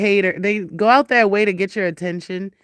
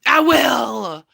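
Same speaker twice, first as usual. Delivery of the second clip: loud, raised warning to a pet; emphatic raised-voice threat; shouted threat; 4 semitones higher, 5 dB louder than usual. Real, not acted. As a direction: threaten the cat loudly. Threatening the cat.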